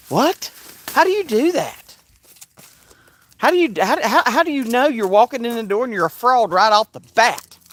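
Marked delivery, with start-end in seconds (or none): 0.1-4.6 much higher disbelieving question; high, stunned disbelief; incredulous. Real, not acted. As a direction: ask it in total disbelief. Incredulous outburst.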